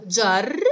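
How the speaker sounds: جرب in three beats, jar - ra - ba; split into syllables. Shown as a demonstration. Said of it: "نقطع هذه الكلمة المشددة".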